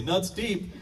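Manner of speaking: a hint of a girl's offhand voice; lightly voiced teenage girl. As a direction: blurt it out like a teen. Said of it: "heard this young lady say".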